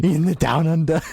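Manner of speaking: put-on playful Australian accent through laughter; mock Australian accent. Teasing his Australian accent.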